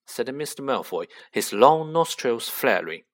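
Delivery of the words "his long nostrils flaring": usual speaking voice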